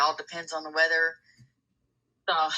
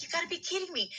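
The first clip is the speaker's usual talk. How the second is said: high and much softer, half to herself; hushed disbelief; 11 semitones higher, 8 dB quieter, much faster than usual. Retold or imitated. Caller retelling news about her ex, high hushed disbelief. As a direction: breathe it out, stunned and drained. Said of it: "It's like".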